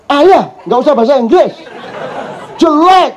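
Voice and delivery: put-on high, scornful child's voice; child telling dad off. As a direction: scold dad like a fed-up kid.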